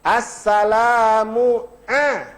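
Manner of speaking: Assalamu a held slowly with a throaty ain; ain not alif. Shown as a demonstration. Ustaz showing the ain in the salam slowly. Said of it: "Ain, bukan a".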